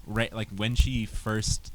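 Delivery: ordinary delivery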